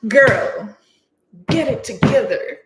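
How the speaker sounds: loud, playful pep-talk to yourself; sassy mock reprimand; mock self-scolding. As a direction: tell yourself off, hammed-up and punchy. Retold scolding of herself.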